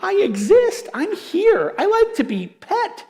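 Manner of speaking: the ignored dog whining for notice; family dog begging while the TV is on; dog's plea. Retold or imitated. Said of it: "our dog is like".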